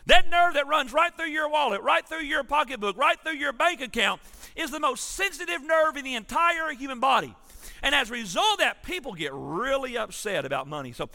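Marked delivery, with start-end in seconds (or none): 0.1-9.1 louder and higher, hammering the point home; emphatic preaching. Acted. Preacher to congregation about money, emphatic and loud. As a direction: drive it home with rising force.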